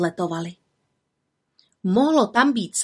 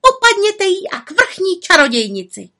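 witches shouting; 11 semitones higher, 6 dB louder than usual. A narrator's reading. Witches demand punishment.

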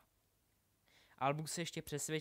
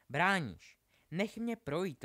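loud, raised-pitch insistence; rozčilené naléhání; 5 semitones higher, 7 dB louder, slower than usual. A narrator's reading. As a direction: demand to be let past. Hermiona se dožaduje průchodu. (rozčileně, důrazně a hlasitě)

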